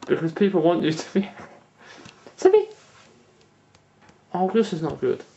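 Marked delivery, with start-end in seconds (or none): 2.4-2.7 high coaxing call; coaxing pet voice. Real, not acted. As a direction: coax the cat sweetly. Calling the cat.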